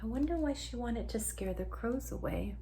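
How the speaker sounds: ordinary delivery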